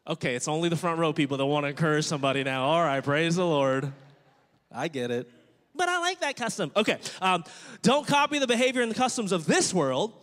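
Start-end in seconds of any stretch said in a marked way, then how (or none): 4.7-6.7 high-pitched protesting churchgoer; mock objection. Preacher voicing a reluctant listener in church, mock objection.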